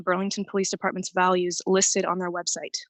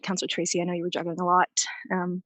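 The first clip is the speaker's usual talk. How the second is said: ordinary talk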